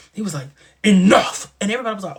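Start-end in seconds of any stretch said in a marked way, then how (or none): 0.8-1.5 loud booming command; mock commanding shout. Imitating Wyatt silencing everyone, loud commanding shout. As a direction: bellow it like a villain. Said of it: "he hit him with that voice".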